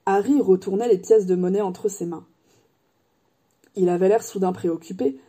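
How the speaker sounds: ordinary delivery, nothing marked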